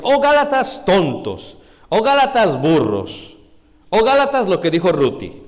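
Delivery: loud, slow exclaimed rebuke; exclamación. Shown as a demonstration. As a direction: call each insult out forcefully. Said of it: "esa es la parte como que está exclamando". (exclamando fuerte y despacio)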